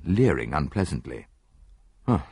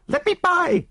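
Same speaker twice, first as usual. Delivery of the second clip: very high, loud panicked cry; terrified shriek; 4 dB louder than usual. Acted. Woman trapped by the door, shrieking in terror. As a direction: shriek to get past the door. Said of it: "she shrieked".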